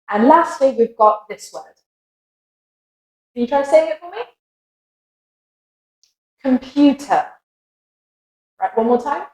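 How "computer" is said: British Computer ending in "tuh"; British accent. Shown as a demonstration. Teacher modelling Computer for learners, British accent. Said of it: "with the American accent".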